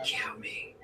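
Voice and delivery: soft breathy whisper of the recording; whispered imitation. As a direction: whisper it like a ghost voice. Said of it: "it almost sounded like it said".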